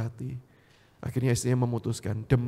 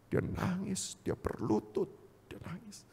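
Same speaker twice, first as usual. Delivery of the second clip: hushed tearful narration; 7 semitones higher, 9 dB quieter, slower than usual. Retold or imitated. Narrates weeping in hushed broken voice.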